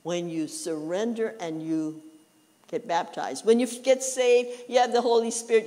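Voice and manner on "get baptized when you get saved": normal talking voice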